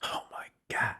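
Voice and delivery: breathless awed whisper. Real, not acted. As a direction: whisper it in disbelief. Awed by the chart.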